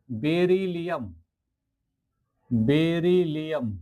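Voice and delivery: each syllable drawn out slowly; slow spelled-out name. Shown as a demonstration. Teacher slowly pronouncing an element name for students. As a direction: sound it out slowly for the class.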